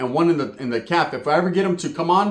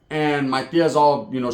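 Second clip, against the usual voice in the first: ordinary delivery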